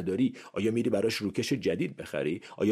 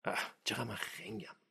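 self-scolding; 5 semitones higher, 8 dB quieter than usual. Retold or imitated.

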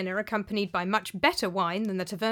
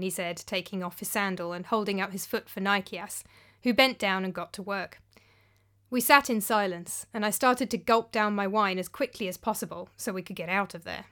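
ordinary delivery, nothing marked

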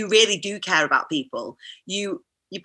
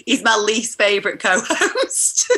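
slow, dissolving into real laughter; teasing through laughter; 3 semitones higher, slower than usual. Real, not acted. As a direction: crack up at your own tease as it ends. Teasing joke, breaks into laughter.

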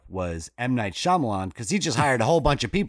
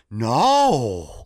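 shocked Mark Wahlberg face; 4 dB louder than usual. Retold or imitated. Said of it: "he does the same shocked, bewildered".

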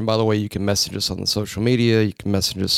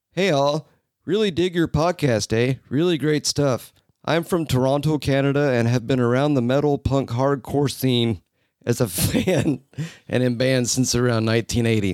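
put-on Canadian accent; 3 semitones higher than usual. Host reading a Toronto listener's message, put-on Canadian accent. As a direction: read it out as a chirpy mock Canadian. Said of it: "read this message in the accent that I think C J".

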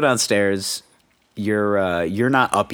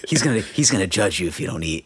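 usual speaking voice

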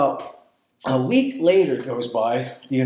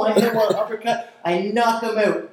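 usual speaking voice

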